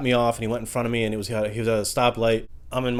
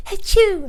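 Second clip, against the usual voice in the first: loud, very high mock sneeze; fake sneeze; 7 dB louder than usual. Kid alone at home sneezing, a loud high mock sneeze. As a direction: blast out a cartoon sneeze.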